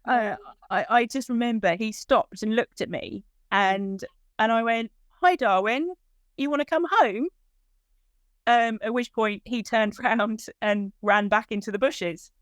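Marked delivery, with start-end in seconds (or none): 5.2-7.3 high, coaxing call to a frightened dog; raised, hopeful call to a lost pet; calling her dog. Retold or imitated.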